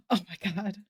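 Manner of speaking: gasping, teary soft cry; choked up. Real, not acted. Streamer moved by a fan's gift, soft teary gasp. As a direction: overcome by the fan art. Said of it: "I'm gonna cry".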